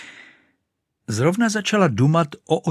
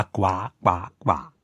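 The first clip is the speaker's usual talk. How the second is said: quacking; 2 semitones lower, slower than usual. Acted. Duck quacking.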